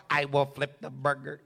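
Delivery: robot voice. Retold or imitated. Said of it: "it'll be robots".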